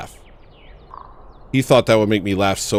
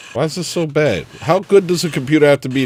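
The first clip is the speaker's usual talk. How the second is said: higher exasperated repeat; 8 semitones higher, 5 dB louder than usual. Real, not acted. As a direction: pitch jumps up in disbelief.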